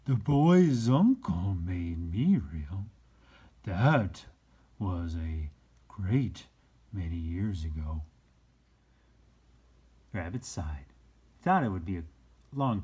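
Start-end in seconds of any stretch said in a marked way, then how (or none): 0.0-8.0 a slow, hint of the old horse; slow and a little louder, the old horse remembering; lightly voiced Skin Horse. A narrator's reading.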